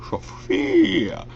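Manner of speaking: villain voice jumping up high. Shown as a demonstration. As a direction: let the word leap up in pitch. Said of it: "my voice is high".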